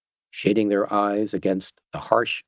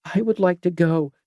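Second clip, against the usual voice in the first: put-on frightened girl; 8 semitones higher than usual.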